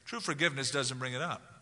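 ordinary talk, nothing marked

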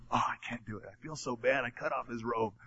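soft, quiet, guilt-ridden murmur; a hint of David's shame, hushed; remorseful. David confessing guilt to his men, softly remorseful.

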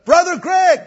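high, loud call across the campus; yelled greeting. Retold or imitated. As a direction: shout his name from far away. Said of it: "and he yelled out".

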